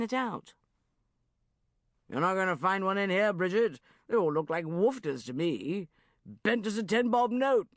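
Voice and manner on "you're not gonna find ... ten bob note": loud hearty uncle voice; blustering Uncle Geoffrey. Acted. Uncle Geoffrey brazening it out to Bridget, loud and blustering.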